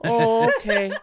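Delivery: put-on high, loud laughing kid voice; giving in with a laugh; meek kid. Calvin agreeing, high laughing kid voice.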